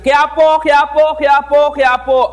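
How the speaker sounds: high, loud taunting chant of Quiapo; mocking shout. Classmates teasing him at the classroom door, loud mocking chant. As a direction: yell it like classmates teasing in a sing-song. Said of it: "sumisigaw sila ng".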